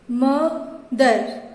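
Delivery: "मदर" broken into म / दर; syllable by syllable. Shown as a demonstration. Teacher showing two syllables, "मदर" said in two separate pieces. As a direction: slowly split "मदर" in two, with a clear pause. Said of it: "टुकड़े हो रहे हैं ना".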